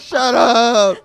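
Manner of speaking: loud, high, laughing snap; angry kid retort, laughing. Retold or imitated. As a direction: yell it back at the teasing girls through laughter. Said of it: "I'm like".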